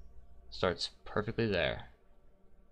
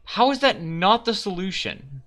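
much higher and louder disbelief; exasperated shout; 10 semitones higher, 7 dB louder than usual. Real, not acted. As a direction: yell at the screen in frustration. Puzzle answer fails.

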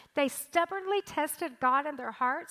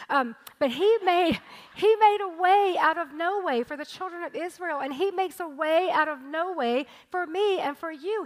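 louder, higher, preaching at full force; rising preaching; 4 semitones higher, 6 dB louder than usual. Acted. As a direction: proclaim it to the whole room. Preaching climax on God's provision.

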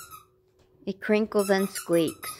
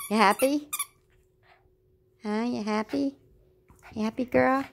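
higher, sing-song questions to the dog; high pet talk; 5 semitones higher than usual. Real, not acted. Mom asks her dog if she's happy, high pet talk. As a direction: lift it up, talking to a happy pet.

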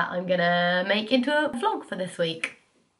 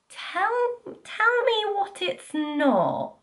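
put-on much higher, slow whiny little-girl begging; pleading child; 12 semitones higher, much slower than usual. Young girl nagging her brother in the bath, high slow whiny plea. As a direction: beg like a spoilt kid in the bath. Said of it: "and I was like".

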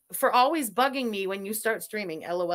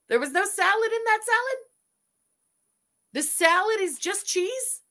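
1 incredulous; 9 semitones higher than usual. Real, not acted. Disbelief at the salad.

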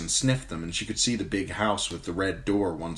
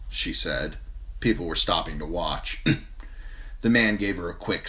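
ordinary delivery

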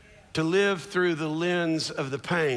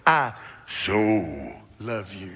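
very deep, drawn-out crooner voice; slow, low love-man impression; 8 semitones lower, much slower than usual. Shown as a demonstration. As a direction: sink low and stretch out SO. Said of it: "Some of y'all got that voice".

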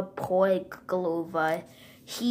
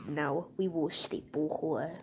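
whisper; 3 semitones lower, 7 dB quieter than usual. Pretend. Villains plot in secret.

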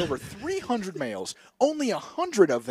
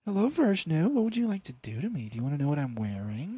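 put-on coy operator voice, pitched up; Ashley; 5 dB quieter than usual. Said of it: "you're connecting with Ashley".

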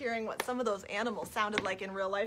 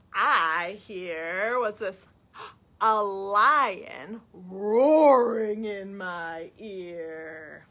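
slow, stretched story line, ROARING drawn out loud; big storytime delivery, falling away at the end; reading to children; 8 dB louder, much slower than usual. Acted. Reading of a lion to children, drawn-out and dramatic. Reads picture book to children.

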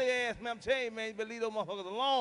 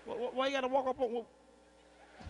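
fast, stammering, spooked; startled stammer; 3 dB quieter than usual. Retold or imitated. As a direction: stumble over the words in fright.

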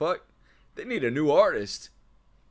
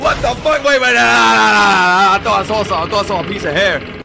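loud, high, rapid-fire; panic-hype at the screen; real hyped yelling; frantic excitement; 7 semitones higher, 10 dB louder than usual. Real, not acted. Frantic reaction to a glimpse.

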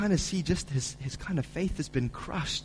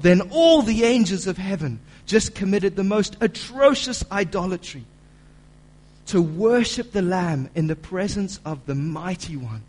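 preaching at full force; 4 semitones higher, 8 dB louder than usual. Acted. Preacher reading John's vision to the church, loud and building. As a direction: read it like a herald.

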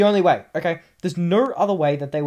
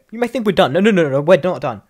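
louder, insistent no-no-no; loud emphatic; 2 semitones higher, 9 dB louder than usual. Real, not acted. Gamer warning viewers the list goes on, loud and insistent. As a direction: hammer home that it isn't over.

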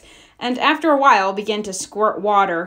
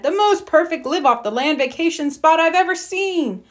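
higher, thrilled bear voice; excited Papa Bear; 7 semitones higher than usual. A narrator's reading. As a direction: gush over the shack. Papa excited about shack.

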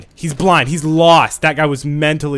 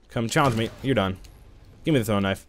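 ordinary talk, nothing marked

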